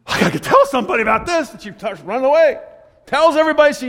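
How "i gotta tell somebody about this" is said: acted astonished cry; quick, excited mock shout; excited outburst. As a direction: blurt it out, rushing to spread news. Voicing the astonished woman running off.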